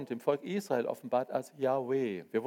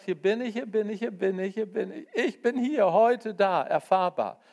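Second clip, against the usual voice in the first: higher, louder, pleading call; open-armed divine appeal; God calling out; 7 semitones higher, 5 dB louder than usual. Acted. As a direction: call the stubborn people to come home. God calling out, quoted in sermon.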